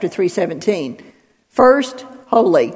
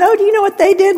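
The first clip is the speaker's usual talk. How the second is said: much higher, silly tittering voice; mock the giddy TV crowd, pitched way up; mock TV giggler; 11 semitones higher, 4 dB louder, much faster than usual. Preacher mocking late night TV audiences laughing at sin, high silly voice.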